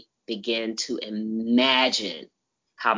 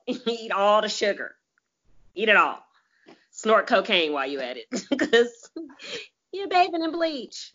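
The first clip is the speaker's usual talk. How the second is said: sarcastic; 10 semitones higher than usual. Acted. Sarcastic high rant, laughing.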